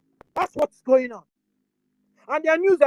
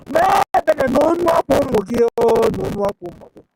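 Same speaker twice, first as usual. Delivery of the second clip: crying out for mercy at full voice; a real, loud plea for mercy, the second half faster; loud plea to God; 2 semitones lower, 8 dB louder, faster than usual. Real, not acted. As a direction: wail the prayer, loud and desperate. Loud prayer for mercy in Igbo.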